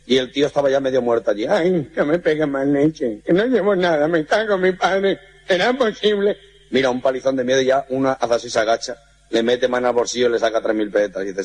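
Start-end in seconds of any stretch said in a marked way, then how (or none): 1.5-6.4 slow, moaning blurred speech; beaten gangoso. Acted. The beaten gangoso moaning at the muggers, slow. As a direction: groan it through muddled consonants. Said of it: "El gangoso".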